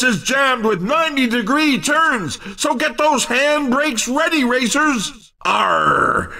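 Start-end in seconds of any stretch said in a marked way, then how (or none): 5.4-6.3 mock pirate. Pirate growl on pirate-themed course.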